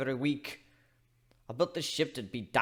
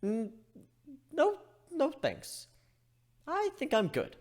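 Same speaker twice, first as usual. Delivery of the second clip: much higher, slow squeaky backing down; nervous refusal; 13 semitones higher, slower than usual. Acted. Griffin declining the nurse's threat, nervous and high. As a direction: back off nervously.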